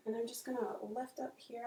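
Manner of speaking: normal talking voice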